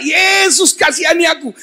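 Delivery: shouting plea. Acted. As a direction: shout it over the crowd. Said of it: "keras berteriak". (teriakan memohon yang tinggi)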